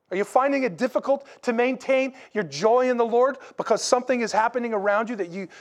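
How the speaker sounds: ordinary talk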